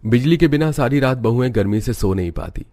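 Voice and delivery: ordinary delivery, nothing marked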